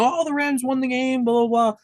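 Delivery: high-pitched mock announcer excitement; mock excited. Podcaster imagining how the announcer should have called the win, mock excited. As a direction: put on a singsong celebration, trailing into blah-blah. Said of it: "instead of being excited".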